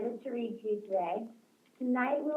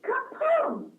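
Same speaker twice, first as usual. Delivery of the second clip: story sound effect; 13 semitones higher, 5 dB louder than usual. Acted. Sudden crash in the story.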